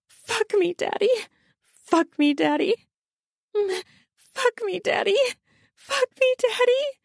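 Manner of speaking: very high whimpered plea; whimpering. A narrator's reading. Adult woman to her lover, whimpering. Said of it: "She whimpers out".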